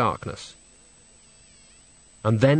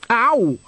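high "ow" cutting off speech; acted pained yelp; 11 semitones higher, 4 dB louder than usual. Nanny gets jabbed mid-remark, high acted yelp. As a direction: yelp as if elbowed.

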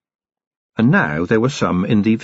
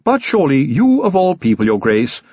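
prim, disapproving clergyman; a stiff churchman complaining; 6 semitones higher, 3 dB louder than usual. A narrator's reading. Clergyman voiced in a read story.

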